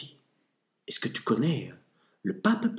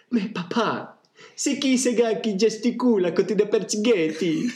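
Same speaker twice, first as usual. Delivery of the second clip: American tourist in mock Italian accent; a tourist child asks in a hammed Italian accent; 8 semitones higher, 3 dB louder than usual. Tourist child asks his dad, in mock Italian accent. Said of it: "le parfait accent italien des touristes américains".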